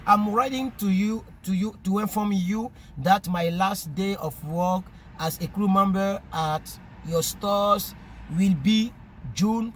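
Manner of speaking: slow letter reading in accented English; strange French-African English accent. Real, not acted. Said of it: "mon accent est trop bizarre". (lecture lente en anglais avec accent africain francophone)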